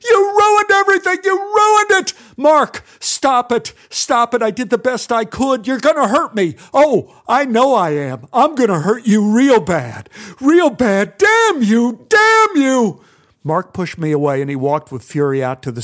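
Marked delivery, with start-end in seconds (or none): 0.0-2.1 high, loud furious scream. Acted. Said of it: "He screamed". 2.4-6.6 high, loud frightened pleading; scared shouting while being shaken; panicked pleading. Acted. 6.7-13.0 high, loud threatening shout; furious threatening yell; menacing rage. Acted. Mark attacking, threatening.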